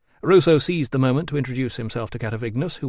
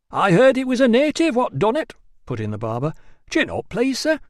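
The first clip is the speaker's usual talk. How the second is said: higher, eager gossip's patter; higher chatty barber voice; 10 semitones higher, 4 dB louder than usual. A narrator's reading. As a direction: prattle on cheerfully to the customer.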